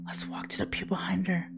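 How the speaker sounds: soft unvoiced whisper. Real, not acted. Creeping to the pew behind her, whispered. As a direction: breathe it so nobody in the pews hears.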